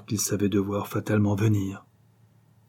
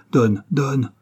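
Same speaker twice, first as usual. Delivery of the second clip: urgent plea; 4 semitones higher, 5 dB louder than usual. A narrator's reading. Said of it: "Pierre bondit".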